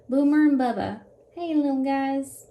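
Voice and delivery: loud, happy hello to the pups; excited dog greeting. Real, not acted. Owner greeting her dogs Boomer and Bubba, loud and delighted. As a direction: call out to your dogs, thrilled to see them.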